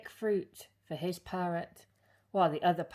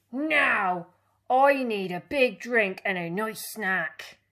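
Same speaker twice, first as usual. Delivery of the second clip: louder, higher pirate captain voice; bluff, gruff old captain; hearty pirate captain demanding treats; captain's voice; 4 semitones higher, 8 dB louder than usual. Acted.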